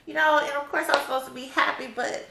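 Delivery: high, loud, sassy exasperation; sassy annoyance. Real, not acted. Overdue mom telling viewers she's annoyed, sassy and high. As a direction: tell them straight, honey, you're done. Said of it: "honey, I am annoyed".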